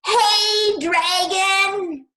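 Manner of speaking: very high, very loud shout; a piercing high shout; shouting as loud as possible. Acted. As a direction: yell right into his ear. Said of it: "shouted as loud as she could".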